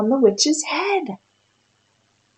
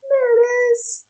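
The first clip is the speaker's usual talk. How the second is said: excited; 13 semitones higher than usual. Real, not acted. Child finds the picture.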